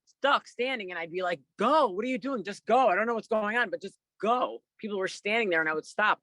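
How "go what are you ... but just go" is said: higher-pitched, insistent retold command; punchy mock urging, raised in pitch; urgent urging. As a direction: re-enact pushing stuck people to move. Urging frozen people to move.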